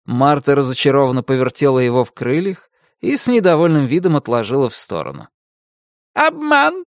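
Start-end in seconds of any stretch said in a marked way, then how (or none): 6.1-6.9 high, slow, outraged crow squawk; indignant crow cawing. Acted. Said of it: "каркнула она".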